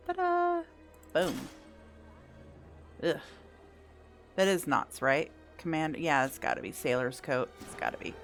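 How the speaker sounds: ordinary talk, nothing marked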